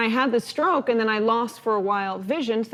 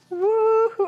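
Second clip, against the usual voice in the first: high whooping cheer, much higher and louder; an excited talk-show cheer for a guest; Oprah-style woo-hoo; 12 semitones higher, 4 dB louder than usual. Pretend. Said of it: "I feel like I'm imitating Oprah".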